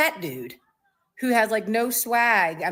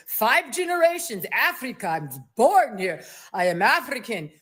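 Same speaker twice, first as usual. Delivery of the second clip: mock Afrikaans accent; 3 semitones higher than usual. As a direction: play the Afrikaner protesting in a put-on accent. Mimicked Afrikaner answering her question.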